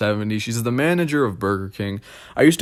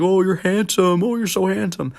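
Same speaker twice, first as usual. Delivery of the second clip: high, gushing mock praise; gushing old relative; 9 semitones higher than usual. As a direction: coo it like a doting old aunt. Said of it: "everyone saying that shit".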